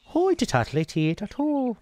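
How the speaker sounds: high, hammed-up Irish lilt; imitating a stage Irish brogue; mock Irish accent. Mocking how he expected Irish people to sound, hammed-up Irish accent. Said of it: "They're not like".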